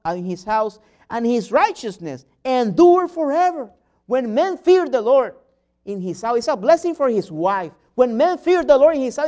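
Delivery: high, loud, fiery sermon delivery; preaching at full force. Acted. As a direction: thunder the verses out to the congregation. Loud scripture reading then preaching.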